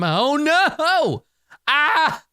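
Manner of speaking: high wail of dismay; dismayed cry. Real, not acted. Player sees the failed dice roll, dismayed cry. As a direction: cry out as the roll goes bad.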